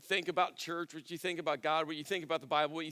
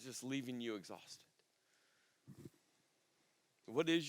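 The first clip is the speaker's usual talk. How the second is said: hushed, lower, probing questions with long pauses; reflective preaching; 4 semitones lower, 5 dB quieter than usual. Real, not acted. Preacher asks congregation to name their burdens, reflective and hushed. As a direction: let each question sink in softly.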